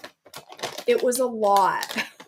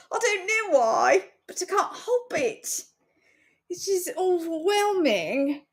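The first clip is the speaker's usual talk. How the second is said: higher mock British voice; hammed-up British accent, pitched up; fake British accent; 7 semitones higher than usual. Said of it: "I woke up British".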